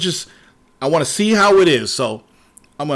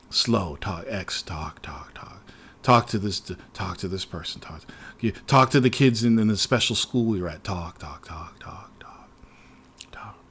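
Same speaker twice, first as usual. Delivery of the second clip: much lower, murmured game-prompt mockery fading to whisper; fading talk; 10 semitones lower, 7 dB quieter than usual. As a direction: mumble the endless prompts away.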